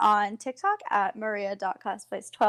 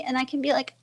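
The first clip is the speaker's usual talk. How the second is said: ordinary delivery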